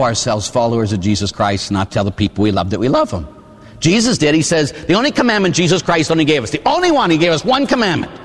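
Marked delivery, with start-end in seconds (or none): none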